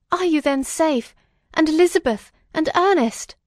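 high, eager, urgent questioning; anxious cry. A narrator's reading. Son asking his father about the family's safety, anxiously crying out. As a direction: cry out for news of loved ones. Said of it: "and cried".

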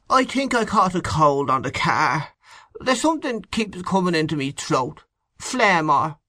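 high, whining sick man; weak whiny bedridden. A narrator's reading. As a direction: complain like a queasy invalid. Sick Kernan complaining.

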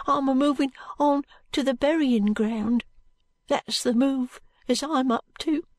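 put-on high, slow, plaintive street boy. A narrator's reading. Jo protesting innocence to Allan, plaintive high voice. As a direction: plead innocence in a small high voice.

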